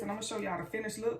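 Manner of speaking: fast, rushed; hurried talk. Real, not acted. Vlogger hurries so the video is not too long, fast. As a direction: rush through it to keep the video short. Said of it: "That's why I'm talking like this".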